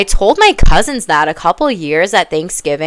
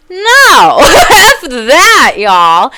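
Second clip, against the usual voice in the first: big laughing outcry, much higher and louder; outraged shout; 9 semitones higher, 9 dB louder, much slower than usual. Real, not acted. Vlogger rejecting boys will be boys, loud laughing outburst. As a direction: blow up at the excuse, half laughing.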